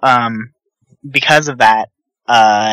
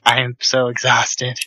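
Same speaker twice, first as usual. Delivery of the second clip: exhausted; slower than usual. Acted. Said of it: "just kinda lean on her, like".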